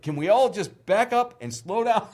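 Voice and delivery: high, commanding; bossy producer. Retold or imitated. Producer voice to medics.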